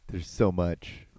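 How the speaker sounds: ordinary talk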